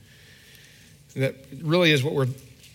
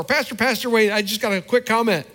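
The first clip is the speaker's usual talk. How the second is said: high, bright hail, then quick request; eager calling; 8 semitones higher, 3 dB louder than usual. Retold or imitated. A thankful member stops the pastor at his car, high eager call. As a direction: catch him before he leaves. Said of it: "you're going out to your car".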